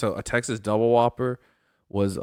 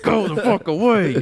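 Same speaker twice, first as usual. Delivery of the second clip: raised, higher mock yell; shooing cats; 8 semitones higher, 5 dB louder than usual. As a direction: chase the cats off loudly. Shooing cats with squirt bottle.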